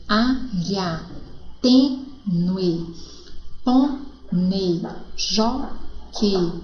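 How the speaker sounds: each word from "Água" to "início" slow, stressed syllable held, ending set apart; from "Água" to "início", split every word after its stressed syllable; drawn-out word list, "Água" to "início", syllables separated; stressed syllable shown. Shown as a demonstration. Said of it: "Observe a posição da sílaba tônica".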